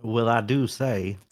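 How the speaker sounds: put-on slow, stately old Southern gentleman; a put-on plantation owner drawl. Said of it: "now you're doing, like, a plantation owner".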